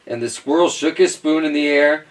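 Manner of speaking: plain reading voice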